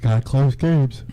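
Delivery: words stretched by a yawn. Real, not acted. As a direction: speak through a yawn. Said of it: "I don't know why I'm so s-, yawning this morning".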